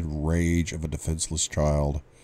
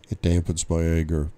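usual speaking voice

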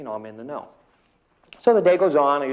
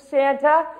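put-on child calling her mom; 14 semitones higher, 9 dB louder than usual. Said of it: "She says".

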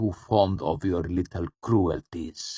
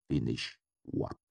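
lower, softer cool question; cool, trailing away; 6 semitones lower, 7 dB quieter than usual. A narrator's reading. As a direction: let the cool voice fall away. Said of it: "His cool voice trailed away".

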